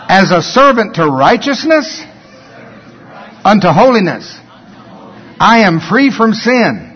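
firm declaration with long pauses between phrases; measured, emphatic recitation of faith; scripture confession. Acted. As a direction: proclaim each verse as a personal confession, phrase by phrase.

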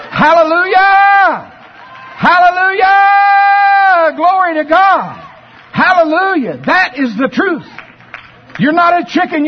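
long, high shouted hallelujahs; preaching at full force. Real, not acted. Preacher shouts praise to the congregation at full force. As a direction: cry it out to the congregation.

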